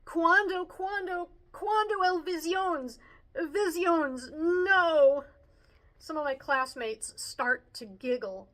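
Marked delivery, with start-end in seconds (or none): 0.0-5.2 high-pitched stammering repeats ending in a loud NO; jumbled, flustered Spanish. A narrator's reading. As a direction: scramble to catch up with the music. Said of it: "Jumbled up Spanish flies out of my mouth".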